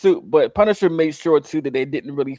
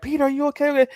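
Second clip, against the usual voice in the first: high-pitched mock concern; worried check; 11 semitones higher, much faster than usual. Retelling Jean checking on wounded Peter, high worried voice. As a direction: ask it high, like a worried friend. Voicing Jean's worry in retelling.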